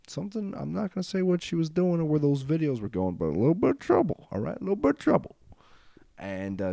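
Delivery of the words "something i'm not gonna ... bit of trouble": climbing high, loud mock-gossip voice; comically overdone coy hinting; hammed-up insinuation. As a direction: push the hint higher and louder each time. Coyly repeating hint of scandal.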